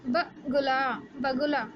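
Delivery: बगुला with a short light गु; short u in bagula. Shown as a demonstration. Teacher to class, showing the short उ in बगुला. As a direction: say बगुला keeping the गु short and easy. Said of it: "ज़्यादा ज़ोर नहीं लगाना पड़ रहा".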